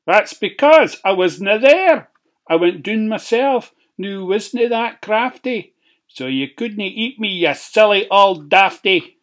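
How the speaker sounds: high, cheeky gloating pig. A narrator's reading. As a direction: crow over the wolf. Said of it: "pig gloats".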